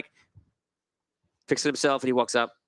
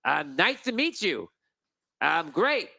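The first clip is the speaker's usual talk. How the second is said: slow, high, pompous nobleman; grandly introducing himself, a pompous gnome lord; 10 semitones higher than usual. Acted. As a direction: greet them like a self-important lord. GM voices Lord Wheaterton.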